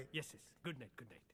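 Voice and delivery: soft, hushed good nights; hushed goodbye. Acted. Slipping away from the uncle, hushed good night. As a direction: keep it down so nobody hears.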